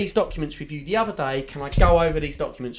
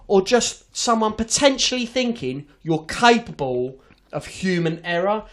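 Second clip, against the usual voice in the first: slow, loud, higher furious tirade, half laughing; furious rant; 4 semitones higher, 5 dB louder, slower than usual. Retold or imitated. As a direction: let the contempt boil over. Inner rant at a liar.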